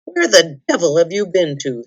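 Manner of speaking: usual speaking voice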